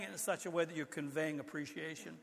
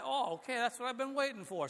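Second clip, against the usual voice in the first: delighted grandpa; 6 semitones higher than usual. Retold or imitated. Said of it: "So I".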